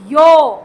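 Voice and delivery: यो said loudly, a clear double sound y plus o; letter name yo. Shown as a demonstration. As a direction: sound out यो firmly for the class. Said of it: "double sounded".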